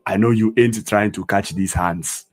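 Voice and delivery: every word fully sounded, "hands" with an open "ah"; unslanged pronunciation. Shown as a demonstration. Teacher showing what he doesn't say, "hands" as "hahnds". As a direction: say "hands" like "hahnts", native African accent. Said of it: "I don't say".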